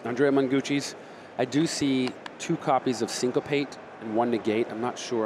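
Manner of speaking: ordinary delivery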